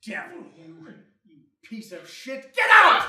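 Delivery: building to a furious yell; angry yelling. Acted. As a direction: spit the insults, then roar. Father rages at daughter.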